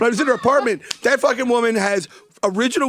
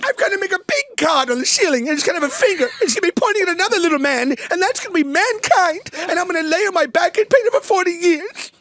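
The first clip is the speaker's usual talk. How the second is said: hammed-up high voice of a pompous Michelangelo; mock pretentious artist; 10 semitones higher than usual. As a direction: whine like a self-important painter.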